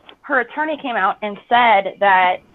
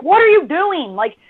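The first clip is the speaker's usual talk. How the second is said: high, loud exasperated shout at husband; yelling at him to keep driving; angry yell; 10 semitones higher, 5 dB louder than usual. Retold or imitated. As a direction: bark at him like he's lost his mind. Yelling at fiancé in retold story.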